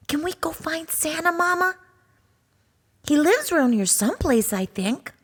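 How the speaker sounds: a cub begging to go find Santa; high, fast cub voice; eager little cub. Acted.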